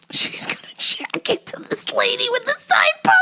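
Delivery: wheezing high through laughter; cracking up. Real, not acted. Teller reaches the punchline, wheezy high laughter. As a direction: squeeze the words out while laughing.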